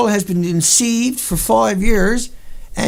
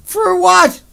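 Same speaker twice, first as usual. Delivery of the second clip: "For what?" yelled much higher and louder; furious yell; 9 semitones higher, 6 dB louder than usual. Real, not acted. Repeating his question, a high furious yell. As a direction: throw it out at the top of the voice.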